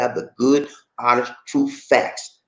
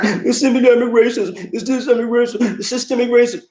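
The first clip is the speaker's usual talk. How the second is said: high whiny mocking repeat; mocking whine, higher; mock crying complaint; 11 semitones higher than usual. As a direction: mimic someone whining about it. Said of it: "can't go around crying".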